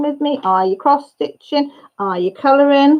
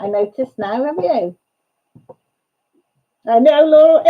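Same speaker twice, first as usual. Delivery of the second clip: ordinary delivery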